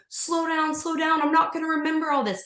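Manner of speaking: high, urgent pleading. Retold or imitated. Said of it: "I kept looking up going".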